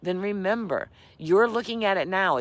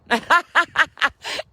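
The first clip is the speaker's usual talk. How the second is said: embarrassed laugh; 9 semitones higher, 6 dB louder than usual. Real, not acted. Embarrassed at own tech blunder.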